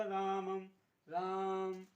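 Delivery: रामम with the aa drawn out as if calling someone; stretched calling. Shown as a demonstration. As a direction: call out राम and stretch the aa. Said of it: "आ देखिए दो बार निकल रहा है".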